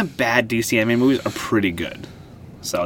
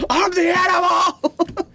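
hammed-up shouting Drax; much slower than usual. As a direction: yell it like a wrestler gone wild. Acting out Drax scene, shouting.

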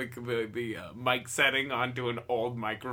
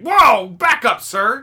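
loud, high shout to back off; mock yell; 10 semitones higher, 14 dB louder, much slower than usual. Comic yelling at a creep to back off. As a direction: bark at him to step back.

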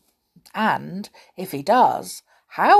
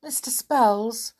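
begging; 5 semitones higher, 5 dB louder than usual. Acted. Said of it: "begged Molly".

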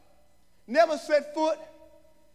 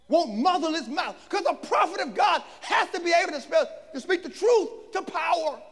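raised, higher preaching voice; full-force preaching; 7 semitones higher, 7 dB louder than usual. Real, not acted. Preacher asking the congregation who John is, full-force preaching. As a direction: proclaim it to the congregation.

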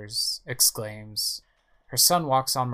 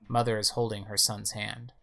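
normal talking voice

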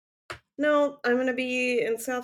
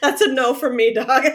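loud, jokey brush-off; laughing mock refusal; 3 semitones higher, 8 dB louder than usual. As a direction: deliver the punchline cracking up. Jokes as scammer, cracking up.

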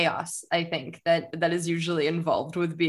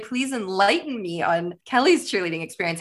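ordinary talk, nothing marked

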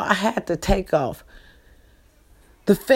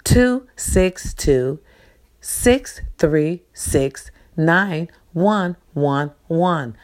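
slow dictation; 3 semitones lower, much slower than usual. Shown as a demonstration. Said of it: "Again, the number is".

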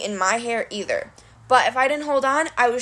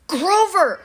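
high, scolding call of a name; fed-up, raised-voice rebuke; exasperated cry; 8 semitones higher, 4 dB louder than usual. A narrator's reading. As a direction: cry out in frustration, pitched up. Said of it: "she cried, exasperated".

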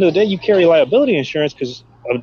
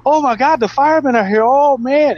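much higher, thrilled onlookers; mock gushing crowd; 12 semitones higher than usual. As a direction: ham up the crowd's delight at the firemen. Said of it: "they're like".

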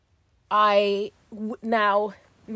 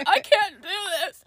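high, louder hammed-up sob; imitating her own crying; mock sobbing; 10 semitones higher, 4 dB louder than usual. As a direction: wail it like you're falling apart. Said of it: "I was pretty much like".

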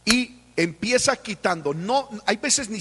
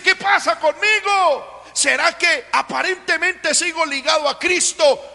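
pleading prayer; 8 semitones higher, 6 dB louder than usual. Acted. Preacher voices a desperate prayer.

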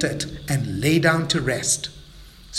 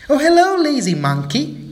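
high, loud mocking greeting; teasing call; 10 semitones higher, 4 dB louder than usual. Friends taunting Mabel, loud and mocking. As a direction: call out to tease him. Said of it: "called out his friends".

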